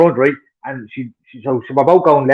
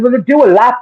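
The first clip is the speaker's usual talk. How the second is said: higher-pitched, indignant retold retort; exasperated retort; 8 semitones higher, 4 dB louder than usual. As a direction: fire back in a retold row. Retold row with parked driver.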